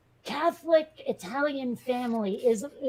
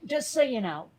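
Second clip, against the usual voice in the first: talking through a mouthful of food; words said round a bite of food; mouth full. Real, not acted. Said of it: "I was talking with my mouth full".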